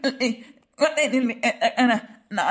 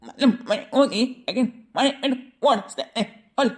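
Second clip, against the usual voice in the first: comic stammer; 3 semitones lower than usual. Acted. Flustered husband stammers a plea against the police complaint. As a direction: lower voice, stuck on first sounds before the words come.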